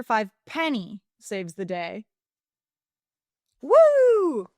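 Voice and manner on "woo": loud, high whoop; cheer. Real, not acted. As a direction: whoop with excitement. Cheering as the chapter starts.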